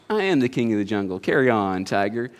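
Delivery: low, soft regal self-assurance; self-satisfied lion. Acted. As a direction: dismiss the tiger like a contented king. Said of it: "And the lion says".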